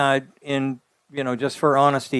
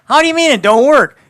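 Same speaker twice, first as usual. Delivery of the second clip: high, loud, indignant disbelief; incredulous outburst; 10 semitones higher, 9 dB louder, faster than usual. Retold or imitated. Engineer retelling his reaction to naysayers, incredulous outburst. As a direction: protest loudly, pitch shooting up. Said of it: "I read these things and I go".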